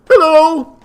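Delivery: put-on high, loud, bubbly fish girl; excitable cuttlefish voice. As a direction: squeal it out like an overexcited sea creature. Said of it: "Help me tell without saying glub".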